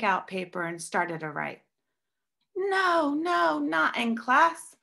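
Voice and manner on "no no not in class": high, slowed-down booming no. A narrator's reading. Teacher stopping students writing in class, high slow boom. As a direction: stop them cold with a big high boom. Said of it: "she boomed".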